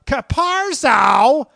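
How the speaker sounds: high, loud, slow drawn-out yell; Tom Hanks impression. Host's one-line Tom Hanks, high drawn-out shout. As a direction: call out to a fallen soldier. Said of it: "one line as Tom Hanks".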